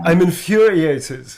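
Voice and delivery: hammed-up Hungarian accent, infuriated; mock Hungarian outrage. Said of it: "a middle-aged Hungarian".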